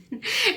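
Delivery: a sudden high laugh; burst of laughter. Real, not acted. Laughing at telling her own story. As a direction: laugh out loud at the memory.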